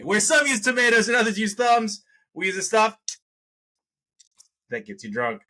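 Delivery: high, loud hyped-up announcer read; announcer slogan. Acted. Host booming the show slogan to viewers. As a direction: punch it like a show tagline.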